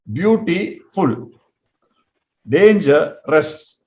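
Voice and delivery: very slow, word pulled apart by syllable. Shown as a demonstration. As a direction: spell out each syllable slowly for the class. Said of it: "can you see two syllables?".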